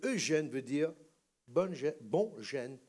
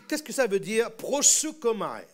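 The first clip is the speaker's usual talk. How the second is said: normal talking voice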